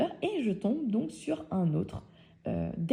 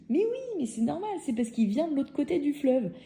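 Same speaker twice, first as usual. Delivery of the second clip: much higher-pitched retold exclamation; exclaimed obviousness; 7 semitones higher than usual. Voicing Félix's amused, obvious explanation. (évidence lancée d'une voix très aiguë)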